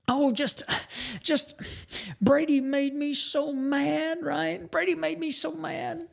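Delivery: put-on high, slow childish whine; imitating a tearful young son; upset little boy. Father imitates his angry young son, high and whiny. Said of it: "and he was so mad".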